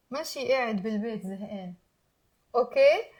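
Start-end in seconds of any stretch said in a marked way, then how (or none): 0.1-1.7 quick, run-together teaching demo; fast, fluent model sentence; faster. Shown as a demonstration. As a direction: rattle the phrase off as one unit. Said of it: "Now faster".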